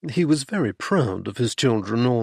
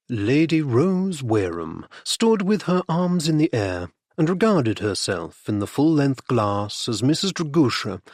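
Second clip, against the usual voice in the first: usual speaking voice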